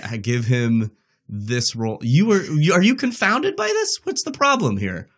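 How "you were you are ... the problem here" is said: high-pitched, mock-exasperated questioning; playful incredulity. Real, not acted. Host pressing her doubting co-host on the casting, playfully incredulous. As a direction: challenge a doubter in a teasing, high-rising voice.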